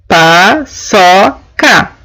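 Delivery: "paçoca" stretched very slowly, syllable by syllable; sounding out syllables. Shown as a demonstration. Teacher sounds out "paçoca" slowly for her class. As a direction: drag out "paçoca" so each syllable is heard. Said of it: "nós podemos perceber aqui".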